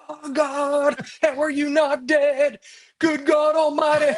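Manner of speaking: high, loud mock alarm at a reading; doctor mock-horrified at patient's blood pressure. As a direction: shout it like the number terrifies you. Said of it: "I'm like".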